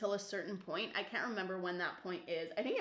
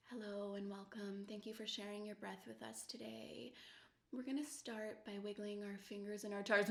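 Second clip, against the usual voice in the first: hushed, gentle instructor voice; soft yoga welcome; 10 dB quieter than usual. Retold or imitated. Imitating a calm yoga teacher welcoming the class, hushed. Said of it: "they come in quietly".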